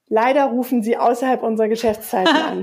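ordinary delivery

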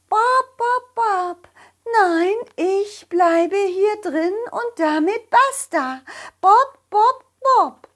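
high, stubborn hen. Acted. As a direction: refuse like a grumpy hen. Stubborn hen inside coop.

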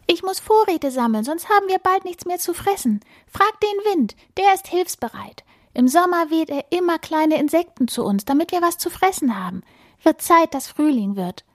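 high, chattering bird voice; busy titmouse. A narrator's reading. (hohe, geschäftige Meisenstimme)

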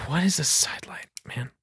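soft, baffled whisper; defeated whisper. Acted. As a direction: whisper it in helpless disbelief. Baffled despair after telling the story.

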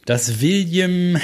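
high, slow suspense; drawn-out dramatic repeat. Acted. Repeats the headline word.

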